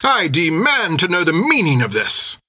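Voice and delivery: high, indignant officer voice; indignant captain. Acted. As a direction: demand it haughtily. Whistle captain protests.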